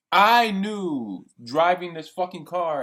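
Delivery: loud, slow, furious; angry outburst. Real, not acted. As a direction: spit each word out in real anger. Angry rant about girlfriend.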